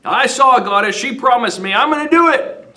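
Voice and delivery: high, loud mock proclamation; excited insisting. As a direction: blurt it out like a man possessed. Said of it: "Priam insisting to wife".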